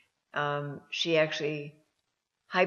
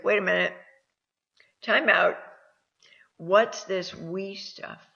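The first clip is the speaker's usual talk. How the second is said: louder, startled cut-in, then slowing down; surprised interruption; 3 semitones higher, 6 dB louder than usual. Retold or imitated. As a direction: stop her mid-story in surprise. Interrupting the interviewee, quoting herself.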